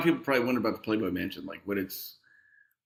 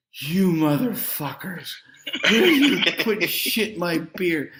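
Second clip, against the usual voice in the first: put-on drunken slur; 4 semitones higher, 3 dB louder than usual. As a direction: slur it like a guy three beers in. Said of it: "starting to slur".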